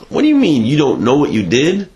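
lightly voiced offended bride; a touch of an accusing wife; indignant wife. Wife's indignant retort.

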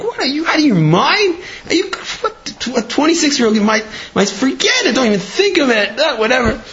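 high, scandalised mother's voice; mock the mother's shrill disbelief at a crazy match; outraged mother.

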